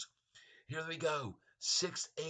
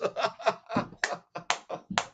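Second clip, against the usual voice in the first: airy, high silent laugh; breathless laugh; 8 semitones higher than usual. Real, not acted. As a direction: wheeze with laughter. Laughing at changed headline.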